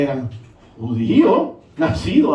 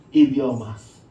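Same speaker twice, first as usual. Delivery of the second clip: IDIOMAS said low, settling downward; lowered repeat; 6 semitones lower, much slower than usual. Real, not acted. Preacher letting the key word sink in, lowered. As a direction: drop the voice right down on IDIOMAS.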